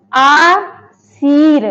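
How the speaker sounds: आशीर stretched very slowly to show the र; drawn-out demo. Shown as a demonstration. Teacher to pupils, stretching आशीर to show र. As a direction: sound out आशीर long and slow. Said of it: "अब रा यहाँ पे आया है".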